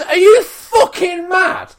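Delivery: high, loud, slow laughing yell; incredulous shout. Retold or imitated. Yelling at the driver blocking the pump, half laughing. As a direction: blast it in disbelief.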